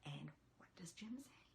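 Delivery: hushed, breathy cue; conspiratorial whispered prompt. Shown as a demonstration. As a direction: lean in and ask the kids in a whisper. Reader cues the refrain.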